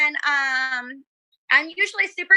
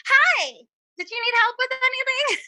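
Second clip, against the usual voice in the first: high, sweet shop-assistant voice; nervous fan faking staff politeness; customer service voice; 10 semitones higher than usual. Retold or imitated. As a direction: put on the helpful clerk voice.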